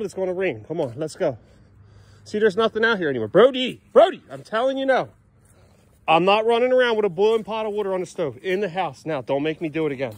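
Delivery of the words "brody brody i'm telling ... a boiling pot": firm call. Real, not acted. Calling the dog firmly, losing patience.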